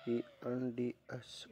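letters spaced out one by one; slow spelling. Shown as a demonstration. Teacher spelling the word slowly for pupils. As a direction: spell it slowly so they can write. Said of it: "friend के साथ आपको S लगाना है".

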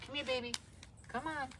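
high coaxing call to the puppy; dog-calling voice. Real, not acted. Owner calls her puppy to come, high and coaxing. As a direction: sing it up high to lure him over.

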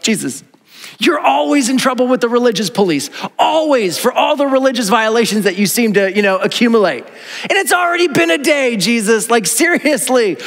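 mock-scold Jesus like a weary buddy; exasperated teasing. Speaker teasing Jesus about his rule-breaking, playfully exasperated. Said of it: "I just have to giggle at this point because I'm like".

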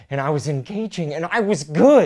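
rising from calm to loud; building passion. Acted. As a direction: build each phrase to a shout. Husband building to a passionate outburst.

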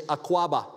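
Akwaba as ah-KWAH-bah, stress on the middle; Twi greeting. Shown as a demonstration. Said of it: "they say in Twi".

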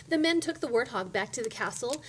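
usual speaking voice

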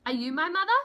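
a little chick calling up hopefully; high baby bird voice. Acted. Baby bird asks the cow, high and eager.

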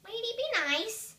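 loud, high telling-off; scolding. Real, not acted. Reader scolding someone nearby, loud and high. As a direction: snap a warning at the interrupter.

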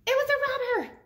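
high, loud gasp of alarm; shocked exclamation. Acted. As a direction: startle the kids. Dramatic story moment.

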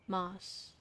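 soft, slow, calming teacher voice; mellow. Retold or imitated. Biology teacher welcoming the class, mellow. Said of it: "She had, like, a very mellow voice".

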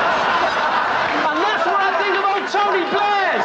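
high, slow luvvie thanks; milk the applause, high and slow; hammy showman. Acted. Hammed bow to applause.

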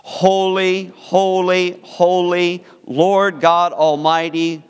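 solemn reading of Revelation, measured pace; scripture reading. Acted. Preacher reading Revelation to the congregation, solemn scripture reading.

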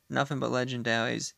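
ordinary talk

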